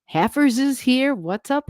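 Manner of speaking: ordinary talk, nothing marked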